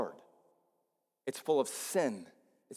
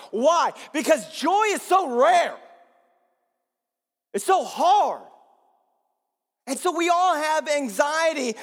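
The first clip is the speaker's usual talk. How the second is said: preaching at full force; 12 semitones higher, 7 dB louder than usual. Acted. Pastor urging the church to look beyond, preaching at full force. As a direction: lift the congregation with each phrase.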